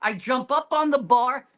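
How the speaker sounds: ordinary delivery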